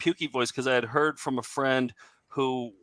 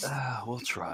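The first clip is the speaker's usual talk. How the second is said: whisper; 11 dB quieter, slower than usual. Acted. Joke about dying young.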